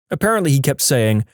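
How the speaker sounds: plain reading voice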